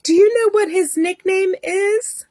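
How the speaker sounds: high-pitched, cooing reassurance; soothing nurse. Retold or imitated. Nurse reassuring frightened patient about her doctor, high and soothing. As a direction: imitate a sweetly doting nurse. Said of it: "pats my foot again and she says".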